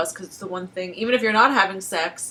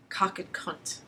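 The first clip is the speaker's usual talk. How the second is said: whispered crude words; 2 semitones lower, 11 dB quieter, slower than usual. Real, not acted.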